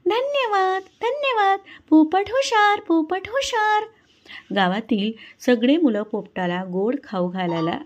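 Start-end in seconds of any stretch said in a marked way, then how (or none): 0.1-3.8 very high chirpy parrot voice; happy parrot. A narrator's reading. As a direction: chirp thanks proudly like a parrot. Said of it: "पोपट आनंदाने ... म्हणतो".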